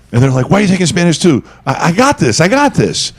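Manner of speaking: ordinary talk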